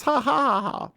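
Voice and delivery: high mock villain laugh. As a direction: laugh like a gleeful cartoon baddie. Mocking villain's glee.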